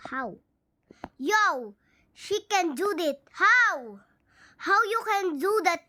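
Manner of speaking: ordinary delivery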